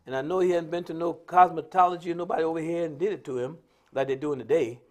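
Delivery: usual speaking voice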